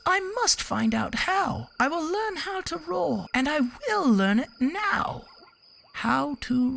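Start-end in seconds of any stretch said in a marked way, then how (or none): 0.1-5.2 much higher little mouse voice, resolved; determined mouse. Acted. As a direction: vow it like a tiny hero. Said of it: "thought the mouse".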